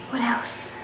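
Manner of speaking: ordinary talk, nothing marked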